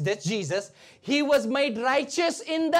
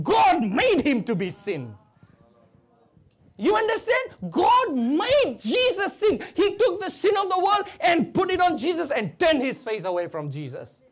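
high, loud, emphatic sermon shout; full-force preaching; 6 semitones higher, 4 dB louder than usual. Real, not acted. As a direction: drive it home to the congregation.

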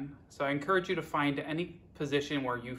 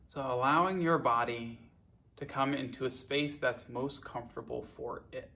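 guided meditation; 7 dB quieter than usual. Acted. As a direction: guide them unhurriedly, letting each phrase settle. Leads a guided body scan.

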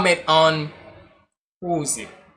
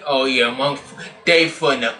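ordinary talk, nothing marked

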